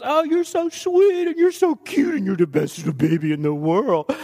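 imitating wife's baby coo. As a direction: coo like a doting grandma. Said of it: "she goes".

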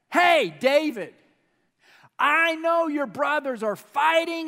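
put-on a father sending his boy off with food; dad calling out. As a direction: call to your son across the house, loudly. Dad voiced calling to David.

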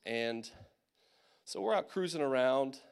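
ordinary talk, nothing marked

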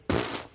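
sharp lip puff of scorn; scoffing pfft. Shown as a demonstration. Pastor demonstrating Sarah's scoffing Hebrew sound, a lip puff. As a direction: spit out a dismissive pfft. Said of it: "That's the Hebrew".

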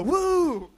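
put-on high, loud toddler whoop; dad imitating son Judah, loud high whoop; imitating child's woo. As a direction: belt out a kid's joyful woo. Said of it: "he'll put his hands up and go".

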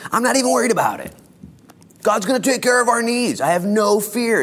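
loud, high, fast declaration; preaching. Acted. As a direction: proclaim it boldly. Bold declaration at full force.

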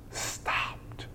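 hushed whisper on one word. Shown as a demonstration. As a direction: drop to a whisper for awe. Dramatic hush on key word.

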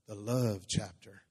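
deep, loud, drawn-out "love"; mock romantic voice. Shown as a demonstration. Preacher hamming up a wedding passage, deep and slow. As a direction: croon it low and slow, stretching LOVE. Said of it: "you gotta say it like that".

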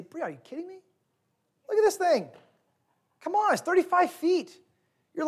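mock the pushy dad who won't listen; dismissive dad. Father at the rope bridge, impatient dismissive pep talk. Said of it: "That would be wrong response".

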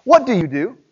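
usual speaking voice

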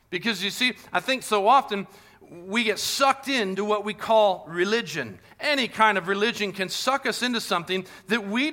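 high, loud fervent sermon; impassioned preaching. Real, not acted. Pastor urging the congregation against empty religion, fervent. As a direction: preach it with conviction.

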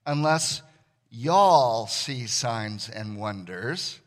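slow drawn-out Southern "y'all see signs and wonders"; preacher mimicking a Southern drawl. Shown as a demonstration. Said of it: "as the Southerners would put it".

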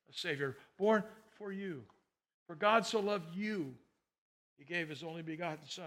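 soft, hushed preaching; tender sermon close. Acted. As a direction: let the message settle gently. Soft closing Christmas message.

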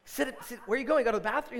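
high, loud, alarmed dad; retold startled call to a child; urgent calling out. Calls after son leaving the cinema.

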